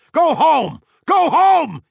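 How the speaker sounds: furious high shout; yelling. A narrator's reading. Montag orders Missus Bowles out, yelling. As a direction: bellow it at full force. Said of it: "he yelled".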